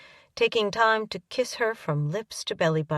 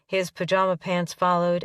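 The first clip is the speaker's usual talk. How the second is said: ordinary delivery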